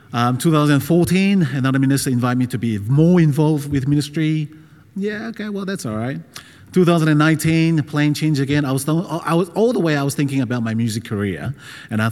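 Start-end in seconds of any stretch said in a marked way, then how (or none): 5.0-6.2 soft, higher-pitched reluctant okay; imitating a mild, hesitant yes; half-hearted agreement. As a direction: agree softly, without conviction. Acts out own hesitant agreement.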